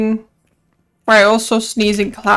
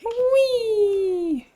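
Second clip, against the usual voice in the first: high squeaky whee while jumping; a gleeful little cry as the character jumps; playful whee; 11 semitones higher, 4 dB quieter than usual. Real, not acted. Streamer jumping, gleeful high whee.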